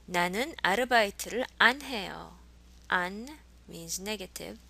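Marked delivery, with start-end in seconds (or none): 0.1-2.4 안 set apart and stressed, loud and clear; over-articulate 안 as a separate word; overly enunciated 안. Shown as a demonstration. Teacher drawing learners' attention to the negative, 안 exaggerated. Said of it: "I'm overly enunciating 안".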